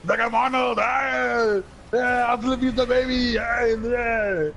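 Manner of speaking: loud, high mock Austrian shouting; over-the-top Austrian action-star yelling; Arnold Schwarzenegger. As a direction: holler in a hammed-up Austrian accent. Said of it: "So he talks like this".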